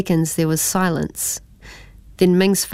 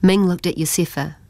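ordinary delivery, nothing marked

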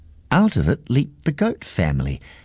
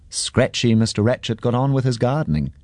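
ordinary delivery, nothing marked

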